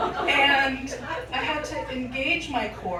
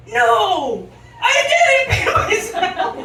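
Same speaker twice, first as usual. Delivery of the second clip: loud, high yelled protest; hammed-up indignant yell; vehement denial; 9 semitones higher, 10 dB louder, much slower than usual. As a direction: draw it out in an outraged shout. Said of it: "vehemently deny it".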